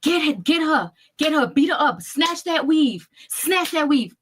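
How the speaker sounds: mock yell of a mother urging a brawl, last lines slowed; retold screaming. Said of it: "the daughter was screaming".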